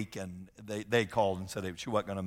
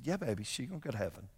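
put-on quick, much softer reassurance to a child; tender reply to daughter; 9 dB quieter, faster than usual. Father gently answers his little daughter, soft and quick. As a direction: hush her gently while holding back tears. Dad soothing daughter in car.

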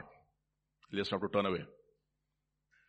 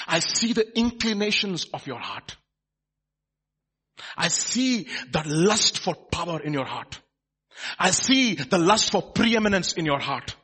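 loud, raised, emphatic accusation; prophetic denunciation; 7 semitones higher, 8 dB louder, slower than usual. Acted. Prophet exposes Hazael's heart, forceful denunciation. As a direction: pronounce it forcefully, measured and piercing.

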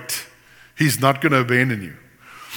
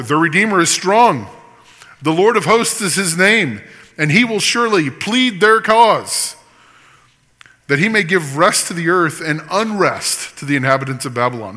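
loud, higher, proclaiming the verse; forceful scripture reading; 5 semitones higher, 4 dB louder than usual. Acted. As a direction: ring it out like a preacher. Reads Jeremiah at full force.

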